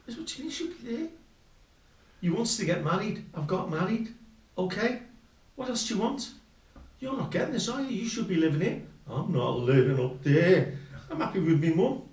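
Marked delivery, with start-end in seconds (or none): 0.2-1.1 high-pitched incredulous echo; retold indignant query. Acted phone quarrel. 2.2-8.8 high-pitched mock exasperation; imitated nagging wife. Wife's side of acted quarrel. 9.1-12.0 loud, drawn-out mock protest; retold defiant refusal. Defiant answer in acted quarrel.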